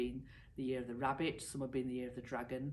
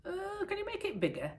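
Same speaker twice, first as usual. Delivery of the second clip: squeezed minister. Acted. Minister gasping in tight robe.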